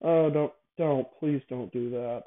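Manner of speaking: ordinary talk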